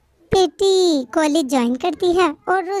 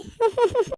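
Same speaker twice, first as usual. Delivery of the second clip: giggle; 7 semitones higher than usual. Acted. Daughter laughs at her own joke.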